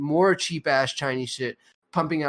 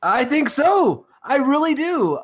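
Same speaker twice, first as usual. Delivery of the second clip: mock solemn; 11 semitones higher, 5 dB louder, slower than usual.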